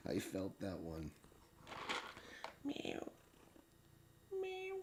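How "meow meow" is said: tiny high squeaky meow; cat meow. Pretend. Owner meows at her cats in a tiny high mew.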